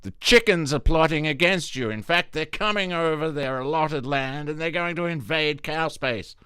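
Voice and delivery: high, insistent farmer voice; distracting farmer. Farmer warns cows about plotting chickens, high insistent voice. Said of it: "He's like".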